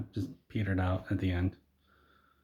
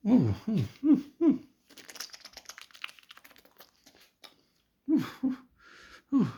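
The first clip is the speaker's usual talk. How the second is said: very high falsetto oohs; weird hooting; 12 semitones higher than usual. Acted. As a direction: hoot in short, high bursts as if transforming. Todd's strange noises as he transforms.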